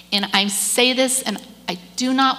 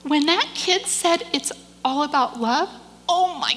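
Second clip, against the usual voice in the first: high, tearful joy building louder; overcome with emotion; 9 semitones higher, 5 dB louder than usual. Real, not acted. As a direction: start choked and high, build to a loud affirmation. Overcome, affirming the kid's answer.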